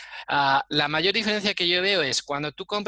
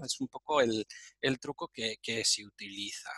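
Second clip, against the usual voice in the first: whispering; 4 semitones lower, 7 dB quieter than usual. Real, not acted. Unvoiced aside.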